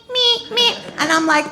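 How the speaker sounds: ordinary talk, nothing marked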